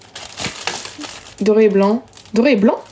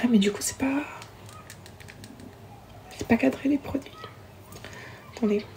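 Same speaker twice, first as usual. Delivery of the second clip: whispered aside; 14 dB quieter than usual. Real, not acted. Off-camera aside fixing the framing.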